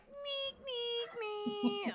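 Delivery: high squeaky mock whine; squeaky hair voice. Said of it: "And his hair is like".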